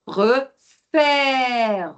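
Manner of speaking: préfère very slow, the second e open and long; slow model of préfère, open è; open è stretched. Shown as a demonstration. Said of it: "observe le deuxième E du préfère".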